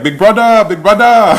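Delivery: mock Nigerian accent, high and loud; [name]. Mimicking a housemate's impression of another, loud mock Nigerian accent.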